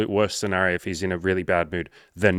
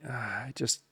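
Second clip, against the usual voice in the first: much softer, trailing apologetic start; sheepish hesitation; 4 semitones higher, 10 dB quieter, slower than usual. Retold or imitated. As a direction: mumble it, embarrassed to say no. Client hesitantly declining coaching.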